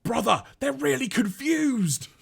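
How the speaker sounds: high, slow, odd villain voice; high put-on Mindwipe voice. Mindwipe telling his brother the Autobots are confused, high and slow. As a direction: pitch it up and drawn out like a creepy villain. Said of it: "Mindwipe's reaction is".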